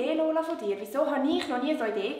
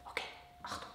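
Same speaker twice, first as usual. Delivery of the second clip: whispering; 18 dB quieter, faster than usual. Real, not acted. Lights going out, hushed.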